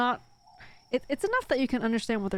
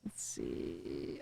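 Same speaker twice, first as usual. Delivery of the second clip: breathy whisper while searching; whisper to self; 14 dB quieter than usual. Real, not acted. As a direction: whisper it under your breath. Muttering to self while searching map.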